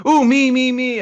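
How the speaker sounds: put-on very high, loud childish pleading; eager little kids. Pupils competing to answer teacher, eager high squeal. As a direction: beg to be picked in a kid voice. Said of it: "classroom of little kids are all eager to".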